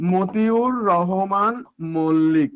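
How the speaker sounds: "Motiur Rahman Mallick" drawn out word by word; slow dictation. Shown as a demonstration. Teacher dictating the martyr's name to students, slow dictation. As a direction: stretch every syllable, pausing before the surname.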